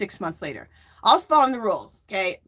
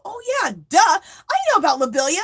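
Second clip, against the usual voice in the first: self-mocking duh; 9 semitones higher, 5 dB louder than usual. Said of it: "I go".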